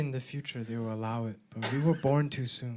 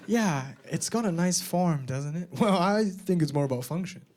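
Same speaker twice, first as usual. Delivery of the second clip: usual speaking voice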